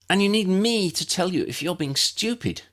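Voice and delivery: put-on high, sharp girl's voice in command. A narrator's reading. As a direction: tell him off like a bossy young witch. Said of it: "Lydia commanded".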